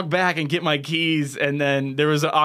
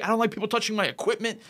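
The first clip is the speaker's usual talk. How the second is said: higher-pitched mock indignation; retold anger; 6 semitones higher than usual. Retelling the friend guarding his studio gear, imitated indignant anger. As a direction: imitate a touchy owner getting mad. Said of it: "just got really mad".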